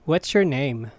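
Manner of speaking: ordinary talk, nothing marked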